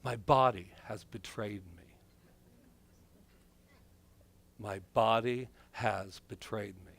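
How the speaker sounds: Shakespearean lament. Acted. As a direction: mourn it like a stage tragedian. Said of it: "Very Shakespeare".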